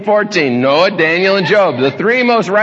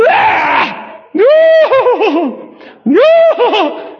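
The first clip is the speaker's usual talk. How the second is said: fake mourners wailing; much slower than usual. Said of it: "three little ladies going".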